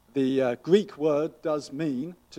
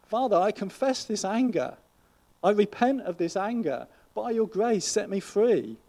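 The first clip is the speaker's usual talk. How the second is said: louder, higher prayer of confession; declared prayer; 6 semitones higher, 4 dB louder than usual. Acted. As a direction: pray it out firmly to the Father. Models a prayer of repentance.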